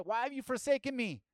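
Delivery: high, loud cry of forsakenness; anguished cry. Acted. Quoting the psalmist's cry to God, loud and anguished. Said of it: "Psalm twenty says".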